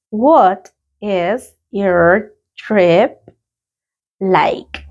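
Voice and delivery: very slow, stretched repetition; a drawn-out classroom repetition; very slow repeat. Shown as a demonstration. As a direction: drag each word out for learners. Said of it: "Let's practice the pronunciation".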